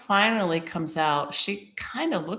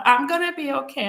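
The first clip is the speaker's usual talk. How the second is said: higher-pitched, mildly emotional retold reassurance; retold anxious self-reassurance; upset insistence; 8 semitones higher, 3 dB louder than usual. Upset, insisting she is okay.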